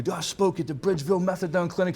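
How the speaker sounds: mock giddy newcomer pride; proud bragging. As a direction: tell everyone like you won the lottery. Bragging after his first speaking gig.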